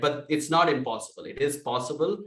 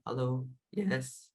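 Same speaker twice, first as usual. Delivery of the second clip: soft, slow, gentle hello; calm sweet person; 7 dB quieter, slower than usual. Retold or imitated. Imitating a calm caller who answers softly. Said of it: "these people who are like".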